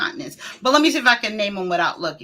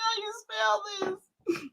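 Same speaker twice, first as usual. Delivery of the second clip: high, softer voice breaking into giggles; giggly mock puzzlement; 11 semitones higher, 10 dB quieter than usual. Streamer imitating herself stuck on spelling, giggly mock puzzlement. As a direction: squeak the question through laughter. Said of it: "I be like".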